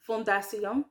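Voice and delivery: "fondation" in French, ending in a nasal "see-on"; French pronunciation. Shown as a demonstration. Learner checking she says that one right, French pronunciation. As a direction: sound each syllable of "fondation" the French way. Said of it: "I think I'm saying that one right".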